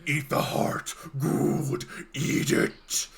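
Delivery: eerie whispery inner voice; unearthly whispered command; voice in the head. Acted. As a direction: hiss it as a sinister whispered urging. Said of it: "you hear in your head".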